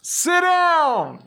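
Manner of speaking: thrown ventriloquist voice, high; lips barely moving. Retold or imitated. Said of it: "I did it in a way ... throw your voice like a ventriloquist".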